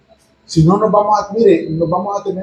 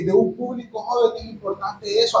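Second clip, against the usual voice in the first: usual speaking voice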